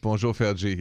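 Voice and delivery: French-accented "Fergie", said as "Fair-zhee". Shown as a demonstration. [name] greeting Fergie his earlier way, French accent. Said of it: "that's my French accent".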